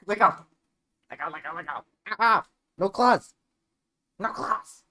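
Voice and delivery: high loud pleading yells; yelling at cat. Real, not acted. Cat hooked into her, loud high pleading yells. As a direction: shout at the cat to release.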